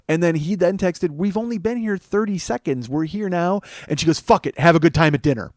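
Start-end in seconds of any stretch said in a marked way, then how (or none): 1.1-3.6 defensive protest. Retold or imitated. Friend protesting by text. 4.2-5.5 loud, raised-pitch huffy dismissal; angry brush-off. Retold or imitated. Friend angrily calling dinner off.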